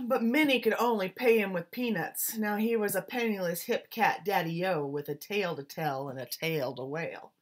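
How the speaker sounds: plain reading voice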